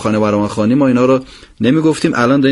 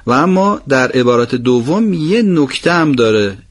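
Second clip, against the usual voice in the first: normal talking voice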